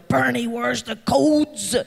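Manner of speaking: slow, weary mock staff voice; imitating the staff. Speaker imitating staff on the phone asking for codes, slow and weary. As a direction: play the patient staff member, drawn out.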